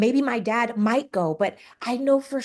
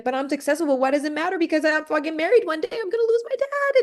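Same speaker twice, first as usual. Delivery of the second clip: spiraling outburst; 9 semitones higher than usual. Retold or imitated. Telling her friend Lee about her spiral, rising in pitch. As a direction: let the panic climb higher with each line.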